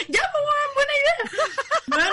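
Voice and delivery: put-on high, slow drunken yes; mock tipsy agreement. Retelling the party, she mimics her drunk self agreeing. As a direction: play along giddily, slurred and high. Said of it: "Y yo como".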